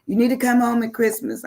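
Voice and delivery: a hint of an insistent mother; imitating mother. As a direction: plead like a mom wanting her child home. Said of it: "She said".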